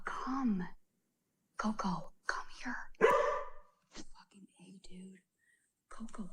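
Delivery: usual speaking voice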